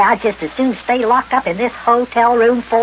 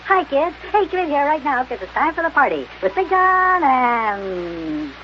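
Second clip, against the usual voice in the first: put-on bright singsong welcome to the kids; kids show greeting; 6 semitones higher, much slower than usual. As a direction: call the kids in to the party.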